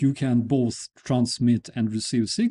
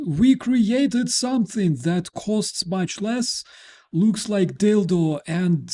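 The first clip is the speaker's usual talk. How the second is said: a hint of a boastful strongman sneering at America; mild mock-boastful Lukashenko rant; 5 semitones higher, 4 dB louder than usual. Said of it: "the Lukashenko is like".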